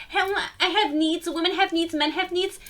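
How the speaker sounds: high-pitched mocking recital; mocking imitation. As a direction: mimic a tiresome complaint up high. Mimicking characters' repeated complaint about needs.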